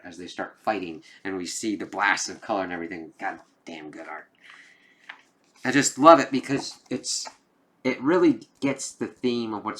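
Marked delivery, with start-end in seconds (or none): 3.2-4.3 hushed admiring whisper; whispered praise, barely voiced. Real, not acted. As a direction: breathe it out in awe. Admiring aside to viewers.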